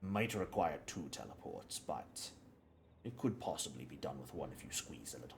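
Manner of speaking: lightly voiced game character, explaining in halting, measured phrases; measured businesslike supplier. Game master as supplier explains the binding to visitors, lightly voiced and measured. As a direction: explain the craft deliberately, with pauses to weigh it.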